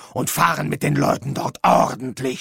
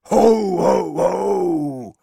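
booming Santa laugh, much louder, first ho higher; 5 semitones higher, 4 dB louder, much slower than usual. Pretend. Death overdoing the Santa laugh at every line. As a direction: tack on a big hearty ho ho ho. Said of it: "du brauchst nicht ständig".

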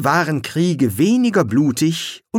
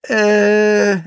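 startled uh; 9 semitones higher, 5 dB louder than usual. Acted. Eric hesitates before answering.

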